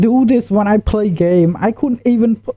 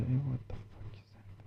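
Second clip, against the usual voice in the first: fast, low, unvoiced whisper; hushed panic, barely breathing; stunned gamer whispering in fright; real scared whisper; 7 semitones lower, 25 dB quieter, faster than usual. Real, not acted. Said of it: "I'm really scared".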